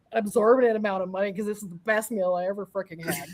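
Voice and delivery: usual speaking voice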